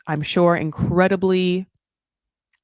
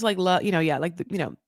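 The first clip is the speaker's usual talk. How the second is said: ordinary talk, nothing marked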